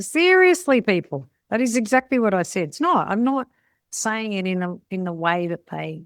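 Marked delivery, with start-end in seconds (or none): 0.0-2.7 high, loud exasperated protest. Retold or imitated. Learner arguing with locals, exasperated and loud. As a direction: throw up your hands in protest. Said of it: "And I think".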